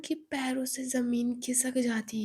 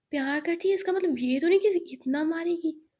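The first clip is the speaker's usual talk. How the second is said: indignant; 5 semitones higher, 6 dB louder than usual. Acted. Zoya angry at the abuser.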